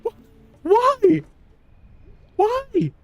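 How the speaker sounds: squeaky, very high "why" at the game; high-pitched disbelief. Real, not acted. Upset at sudden setback in game.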